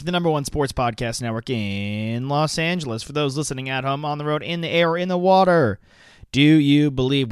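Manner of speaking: big hype announcer voice; grand entrance announcement. Acted. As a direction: sell it like an arena announcer. Podcast host hyped intro.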